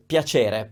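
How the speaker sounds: piacere with a clear ch, slow; standard ch sound. Shown as a demonstration. Teacher demonstrating the ch in piacere. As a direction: hit the ch in piacere. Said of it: "the ch sound".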